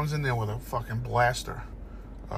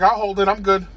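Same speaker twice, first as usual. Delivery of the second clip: dog voice; 5 semitones higher, 8 dB louder than usual. Acted. Dog refusing the rain.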